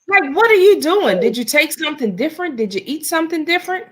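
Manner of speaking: high, fast disbelieving questions; can't believe what she's seeing; incredulous. Real, not acted. Asking co-host in disbelief.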